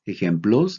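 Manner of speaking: ordinary talk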